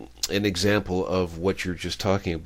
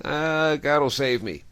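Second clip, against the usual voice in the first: mild acted yell, pitched higher; raised, higher voice brushing off help; yelling back; 8 semitones higher, 3 dB louder than usual. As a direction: call back dismissively from the house. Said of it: "he yells back out the house".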